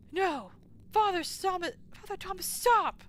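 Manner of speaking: high, loud pleading cry; desperate shout. Acted. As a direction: beg the father to stop. Pleading with the priest in-game.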